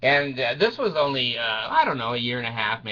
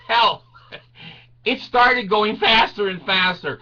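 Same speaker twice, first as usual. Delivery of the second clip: ordinary talk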